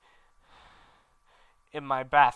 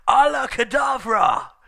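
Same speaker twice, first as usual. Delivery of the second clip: much higher, louder and slow shout in a put-on voice; shouted curse; 11 semitones higher, 8 dB louder than usual. Voldemort shouting the curse as they burst into his lair. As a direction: hurl the killing curse. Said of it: "heard a croon voice say".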